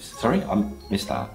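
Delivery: ordinary talk, nothing marked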